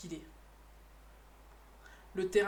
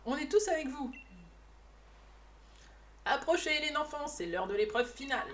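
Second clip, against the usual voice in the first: put-on old man voice; 4 semitones higher than usual. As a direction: play a cheerful old master. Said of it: "J'essaye de lui faire une voix de vieux".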